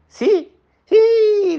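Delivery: yelped sí sí, very high and loud; eager agreement. Acted. The whole otter family agreeing eagerly, high and loud. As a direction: everyone jumping at the idea. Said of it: "dijeron todos".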